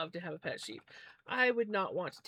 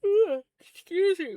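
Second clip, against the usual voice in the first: words stretched through a loud yawn; 10 semitones higher, 11 dB louder than usual. Real, not acted. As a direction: yawn right through the apology. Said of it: "Why am I yawning".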